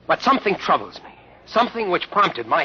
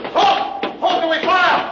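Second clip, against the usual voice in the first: patrol shout. Acted.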